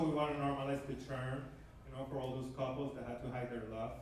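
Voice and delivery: normal talking voice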